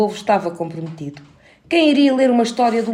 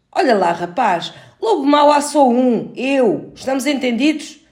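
stern wolf voice; 5 semitones higher, 4 dB louder than usual. A narrator's reading. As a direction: lay down the law as the wolf. Bad wolf warns.

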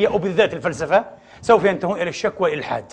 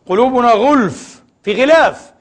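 emphatic declaiming; 5 semitones higher, 7 dB louder, slower than usual. Acted. Preacher quoting Quran with force.

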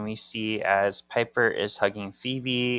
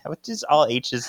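ordinary talk, nothing marked

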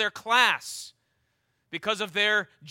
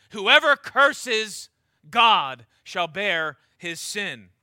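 "Whoever" loud and drawn out, then weighty pauses; very slow, forceful stress on "Whoever"; emphasis on whoever; 5 dB louder, much slower than usual. Shown as a demonstration. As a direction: proclaim it word by word, hammering "Whoever". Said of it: "Hear the emphasis on the word whoever".

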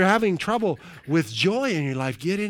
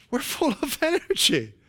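high-pitched, real laughter breaking into the words; laughing; 13 semitones higher than usual. Real, not acted. Laughs amid repeated declaration.

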